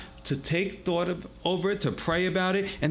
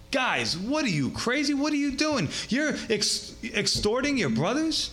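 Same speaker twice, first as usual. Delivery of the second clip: higher-pitched Bronx confrontation; Bronx style; 9 semitones higher than usual. Acted. Nehemiah confronting the nobles for extorting brothers, Bronx style. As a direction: call them out in mock Bronx style. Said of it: "Bronx style there".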